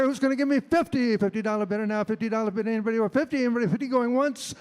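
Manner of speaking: fast rhythmic auction chant; auctioneer. Acted. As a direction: rattle off bids, loud and high. Auctioneer bid calling.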